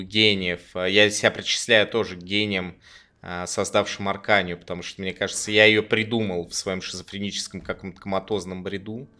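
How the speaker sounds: low, quiet mock-grandiose sarcasm; sneer, pretending to be a genius; sarcastic. Real, not acted. Ironic low mock-grandiose monologue. (низкий саркастичный тон)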